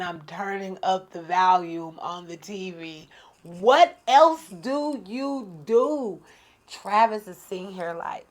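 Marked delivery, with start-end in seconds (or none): 3.4-6.2 slow, loud, raised pitch; exasperated rhetorical question. Real, not acted. Woman asks viewers what else one could do, drawn-out exasperation. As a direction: stretch out every word for comic emphasis.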